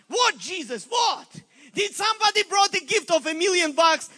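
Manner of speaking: loud, high excited questioning; eager questions. Retold or imitated. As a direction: angels rushing in to Jesus, excited questions. Angels asking Jesus, curious.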